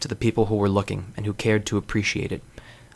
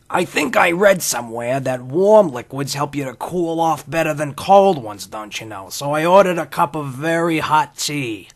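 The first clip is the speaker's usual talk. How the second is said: put-on slow, higher old-timey drawl; Henry Miller impression; 5 semitones higher, 6 dB louder than usual. Host imitating Henry Miller ordering tea in a cafe, slow and higher. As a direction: do a slow, higher old writer musing aloud. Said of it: "That's my Henry Miller impression".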